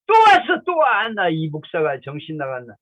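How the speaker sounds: high, loud outburst imitating an angry refuser; clamouring. Mother fed up with the pastor's return visit, imitated clamouring. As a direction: screech it in mock outrage. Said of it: "막 그냥 아우성을 쳐".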